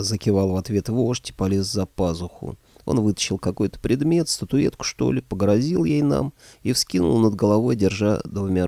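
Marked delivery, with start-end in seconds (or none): none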